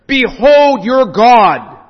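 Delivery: slow, loud ringing "Behold" that falls; proclaiming shout. Acted. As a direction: call it out to the cities. Proclaiming the prophetic call.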